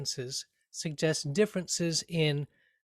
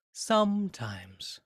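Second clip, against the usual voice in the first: very slow "sometimes" with heavy "some"; stress on some; 3 semitones higher, much slower than usual. Shown as a demonstration. Teacher drawing out "sometimes" with stress on "some". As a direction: hold and weight "some" in "sometimes". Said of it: "the "some" gets more weight".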